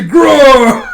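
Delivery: very high, very loud growled cry; monster roar. Acted. Mudmaster slams his hand down, roaring. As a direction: roar as the beast attacks. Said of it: "as this Mudmaster just, like, slams his hand down".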